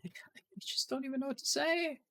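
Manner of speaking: breathless defeated whisper. Real, not acted. Player whispering in disbelief at the broken game. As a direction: whisper it, utterly lost for words.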